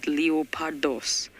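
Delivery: slow, drawn-out Greek leopardos; Greek accent. Shown as a demonstration. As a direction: say leopardos slowly, Greek style. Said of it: "I don't know why I said it like that".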